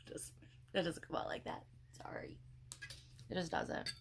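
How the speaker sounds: sheepish whisper; whispered aside. Real, not acted. Admitting to viewers she's a bad storyteller, whispered. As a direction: confess it in a whisper.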